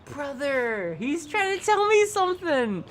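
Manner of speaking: high cooing voice to a pet. Real, not acted. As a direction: coo sweetly at the little shark. Cooing at a game shark.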